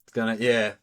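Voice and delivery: usual speaking voice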